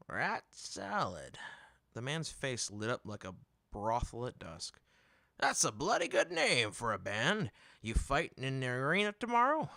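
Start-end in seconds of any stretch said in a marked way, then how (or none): 0.1-1.4 savoring repeat. Acted. Merchant repeats band name. 5.4-9.7 higher, louder huckster enthusiasm; a salesman who smells a sale; eager merchant. Acted.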